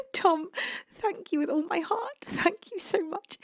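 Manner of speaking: soft, high voice with a small laugh; tearful thanks. Real, not acted. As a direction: thank them tenderly, close to tears. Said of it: "stop making me cry".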